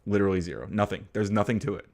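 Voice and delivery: ordinary delivery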